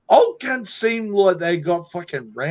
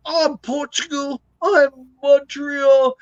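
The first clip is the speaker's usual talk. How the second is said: mock gangster; 5 semitones higher, slower than usual. Mocking tough-guy posturing.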